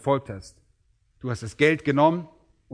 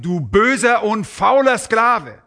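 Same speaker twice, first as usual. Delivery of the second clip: stern rebuke; 7 semitones higher, 6 dB louder, slower than usual. Retold or imitated. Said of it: "sein Herr antwortete und sprach zu ihm".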